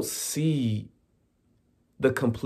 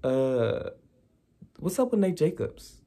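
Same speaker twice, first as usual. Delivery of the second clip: higher, pointed mock-innocent question; sassy callout; 4 semitones higher, 3 dB quieter than usual. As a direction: drop the bombshell with sass. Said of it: "Rue is not playing that game".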